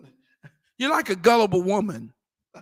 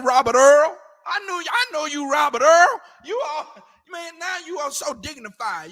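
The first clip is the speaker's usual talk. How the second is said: put-on teasing old friend; 7 semitones higher, 4 dB louder than usual.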